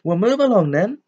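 high, loud, sharp command. A narrator's reading. Professor to a bedraggled crowd, sharp order. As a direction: order people along briskly and sternly. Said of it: "said Professor McGonagall sharply".

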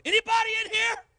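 loud high call into the empty church; yelling. Retold or imitated. Preacher calling into the empty church, loud high yell. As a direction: call out across the dark sanctuary. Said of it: "And I yelled".

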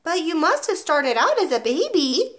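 put-on young boy daring to argue; little boy. A narrator's reading. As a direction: pipe up high, pressing the point. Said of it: "the boy said".

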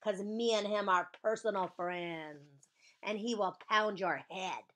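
loud threat, easing as it goes; big hollered tattletale threat; yelling. Acted. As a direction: yell a warning, then draw out the boast. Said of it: "I yelled".

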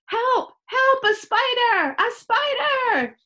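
very high, loud cries for help; panicked shout. A narrator's reading. Chloe finding a spider, shouting in panic. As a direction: shout in shrieking alarm. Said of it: "Chloe shouted".

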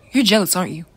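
ordinary delivery

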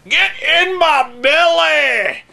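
high, slow, shouted Blob impression. Mocking the Blob from the Wolverine movie, hammed-up shout. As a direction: bellow it like a greedy blob monster. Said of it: "the Blob in the Wolverine movie".

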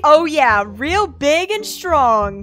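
slow, drawn-out fake flirting; hammed-up admiring coo; playing along. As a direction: flatter him with mock admiration. Plays along, flattering the stalker.

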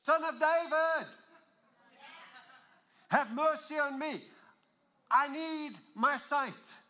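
high, very loud desperate plea; screaming it out. Acted. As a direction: cry out to be heard, stretching the words. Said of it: "I would be screaming it out".

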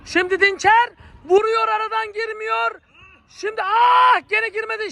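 high, shouted play-by-play; excited commentator shout. Acted. Live play-by-play, near miss.

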